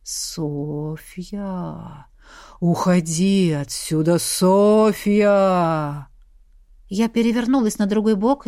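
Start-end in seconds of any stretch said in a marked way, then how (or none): 0.0-6.1 low, slow ghostly wail; sepulchral ghost voice. Acted. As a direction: moan it like a ghost from the grave. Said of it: "раздалось замогильное".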